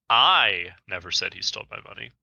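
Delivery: "I" hit hardest at the start; stress on I. Shown as a demonstration. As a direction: punch the opening "I". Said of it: "For example".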